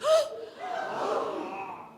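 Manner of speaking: high gasp with a laugh; big surprised gasp. Shown as a demonstration. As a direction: gasp like a lion face. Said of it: "a surprised face".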